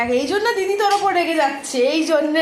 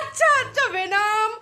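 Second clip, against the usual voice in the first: high-pitched, laughing pet talk; playful placating; 7 semitones higher, slower than usual. Real, not acted. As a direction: placate the angry dog in a high, amused voice. Placating an angry dog, laughing. (হাসতে হাসতে চড়া গলায় আদুরে সান্ত্বনা)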